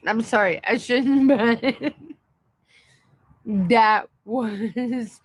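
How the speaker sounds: apologizing through real giggles; real helpless laughing. Real, not acted. Streamer apologizing to viewers for finding the ban funny, helplessly laughing. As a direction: try to talk but break into laughter. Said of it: "laughing about this".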